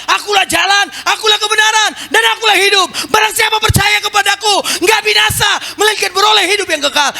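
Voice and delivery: high, ringing preacher's shout, slowing down at the end; bold proclamation. Shown as a demonstration. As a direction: cry it out to the whole church with full conviction. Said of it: "Kenapa dia berani bilang".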